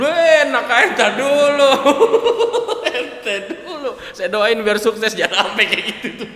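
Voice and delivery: high, loud, laughing protest; loud laughing brush-off; laughing retort. Real, not acted. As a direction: laugh it off and push the joke back at him.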